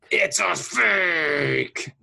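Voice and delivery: hugely drawn-out mock outburst; slow, overwrought film-villain impression; imitating meme line. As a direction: stretch each word into a hammy cry. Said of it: "what kind of emotion he's expressing".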